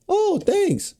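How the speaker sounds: high, breezy thank-you; mock cashier. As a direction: squeak out a surprised thanks. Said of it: "She's like".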